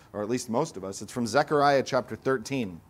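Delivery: ordinary talk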